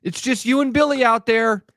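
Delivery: high mock-encouraging parent calls; sideline cliches. Parent calling cliches to their kid on the mound, mock encouraging. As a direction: rattle off cliches like a hovering parent. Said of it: "the cliches when a kid is on the mound".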